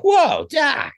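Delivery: high startled Whoa, Doc; Marty voice. Retold or imitated. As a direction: Fox slips into his Marty. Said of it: "he's like".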